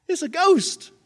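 high, hammed-up scare; frightened cry. Disciples seeing Jesus on the lake, mock-terrified cry.

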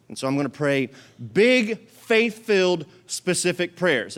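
"big, faith-filled, specific prayers" each word hit apart, rising; punched call words. Shown as a demonstration. Pastor sets the phrase for the church, each word punched. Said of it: "Everybody say that with me".

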